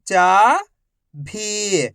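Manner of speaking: each syllable slowly held, first one rising. Shown as a demonstration. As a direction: break the word into long, separate syllables. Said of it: "एक अक्षर का उच्चारण करके".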